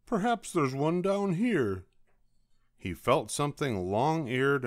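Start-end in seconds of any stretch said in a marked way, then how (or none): 0.1-1.9 high, hopeful little character voice; lightly voiced Douglas. A narrator's reading. Douglas searching for a hug, high hopeful voice. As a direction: lift it high, wondering.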